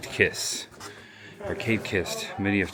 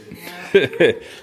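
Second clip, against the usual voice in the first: hearty laugh; 9 semitones higher, 11 dB louder than usual. Real, not acted. Laughs at Lover's Leap joke.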